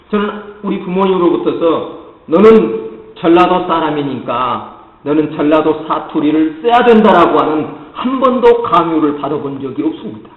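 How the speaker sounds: normal talking voice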